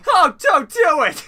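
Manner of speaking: high-pitched, very loud plea; mock panic. Player overacting a plea not to drink, panicked shriek. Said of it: "A voice changes".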